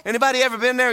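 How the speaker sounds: ordinary talk, nothing marked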